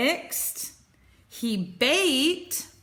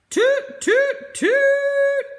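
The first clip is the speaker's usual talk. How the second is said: high, loud horn-like toots; 8 dB louder, much slower than usual. Acted. Mole playing his horn to wake Bear, loud and high. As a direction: blast it out like a little horn.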